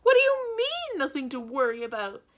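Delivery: very high, loud disbelief; a banker disbelieving the calm villain; incredulous. A narrator's reading. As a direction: squeak it in disbelief. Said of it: "Mister Poe said incredulously".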